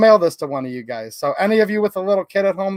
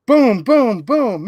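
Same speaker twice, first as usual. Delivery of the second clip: higher, swelling boom on each beat; thunder booms; 3 semitones higher, 3 dB louder, much slower than usual. Acted. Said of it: "Mister Brown makes thunder".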